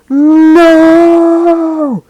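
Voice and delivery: high, loud anguished "No!"; hero's scream. Retold or imitated. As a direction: cry out as the hero sees him fall. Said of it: "All Might's like".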